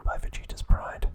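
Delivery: usual speaking voice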